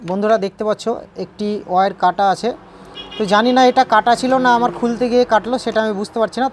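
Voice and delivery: ordinary talk, nothing marked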